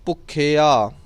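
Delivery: ਭੁੱਖਿਆ ending in open, un-nasal "aa". Shown as a demonstration. Teacher noting there is no bindi, clean ending. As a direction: finish ਭੁੱਖਿਆ on a clean long aa. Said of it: "ਬਿੰਦੀ ਨਹੀਂ ਲੱਗੀ".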